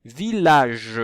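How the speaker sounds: VILLAGE loud, with a long final -ge; teacher sounding out VILLAGE for listening; drawn-out word demo. Shown as a demonstration. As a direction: hold the last syllable of VILLAGE. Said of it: "est-ce qu'on entend".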